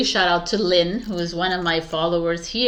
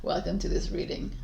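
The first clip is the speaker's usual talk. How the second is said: muttered retry; 4 semitones higher, 6 dB quieter than usual. Shown as a demonstration. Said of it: "what the hell does that even mean?".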